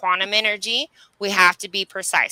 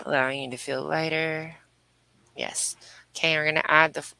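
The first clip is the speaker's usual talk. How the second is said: guided meditation; 3 semitones lower, 4 dB quieter than usual. Acted. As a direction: ease them through each breath, unhurried. Leads breathwork healing meditation.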